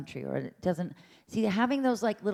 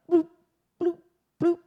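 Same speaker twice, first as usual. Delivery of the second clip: blip sounds; 12 semitones higher, 5 dB louder than usual. Shown as a demonstration.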